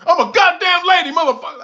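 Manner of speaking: robot lady furious at being questioned, high and loud; angry robot lady. Pretend. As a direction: roar it out, insulted. Robot lady shouts back.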